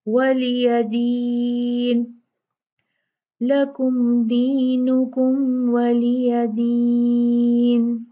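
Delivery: very slow chanted tajweed recitation; Quranic recitation. Shown as a demonstration. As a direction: recite it, drawing out the madd. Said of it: "होंठों को गोल करेंगे". (धीमी तजवीद वाली तिलावत)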